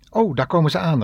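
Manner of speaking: a cheerful mock call to arriving colleagues; retold call. Doctor spotting his colleagues arrive, a lightly acted call. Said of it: "riep hij".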